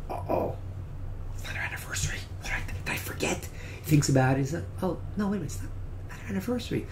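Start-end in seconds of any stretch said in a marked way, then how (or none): 0.1-3.5 whispered worry to himself; panicked whisper. Retold or imitated. Husband panics privately about anniversary. 4.8-6.9 fast whisper, checking with himself; relieved whisper. Retold or imitated. Husband reassures himself privately.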